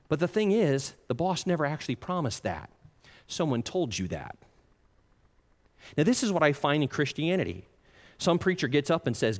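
usual speaking voice

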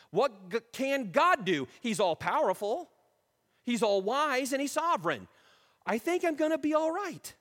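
loud, high preaching swell; rallying confidence. Acted. Man talking himself out of discouragement, loud and triumphant. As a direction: build to a high, ringing declaration.

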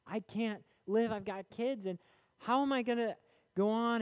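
building cry of a widow who can't go on; imitating desperate grandma. Widow crying out to God about her kids, high and building. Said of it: "she's like".

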